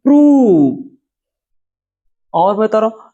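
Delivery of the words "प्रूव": प्रूव high and loud, falling; model word prove. Shown as a demonstration. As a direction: stress the long oo in प्रूव. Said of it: "इसे कहते हैं". (प्रूव ऊँचे ज़ोरदार स्वर में)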